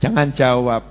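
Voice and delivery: normal talking voice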